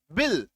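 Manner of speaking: बिल with a short "ih" vowel. Shown as a demonstration. Teacher applying the vowel rule, बिल with short i. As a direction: say बिल with छोटी ई. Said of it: "ई होगा".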